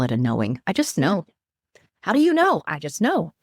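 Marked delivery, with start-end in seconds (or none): 2.0-2.6 much higher, louder incredulous question; higher, louder mock doubting challenge; mock skeptic.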